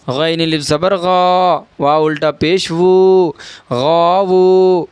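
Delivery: slow spelling-out, long held "غا" and "وو"; drawn-out spelling. Shown as a demonstration. Teacher building the word for learners, "غاوو" slowly drawn out. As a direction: stretch "غا" and "وو" evenly. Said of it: "الف مدہ کو ایک الف کے برابر کھینچ کر پڑھیں".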